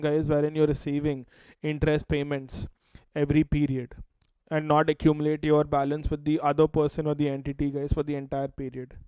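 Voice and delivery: normal talking voice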